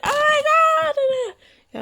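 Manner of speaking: high-pitched, loud scream; retold scream. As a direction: scream it out like dad in panic. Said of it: "it screams".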